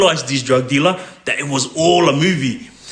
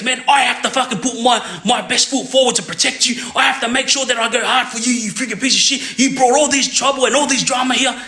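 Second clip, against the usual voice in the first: mock angry rant; 6 semitones higher than usual. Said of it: "start going off, putting on a movie".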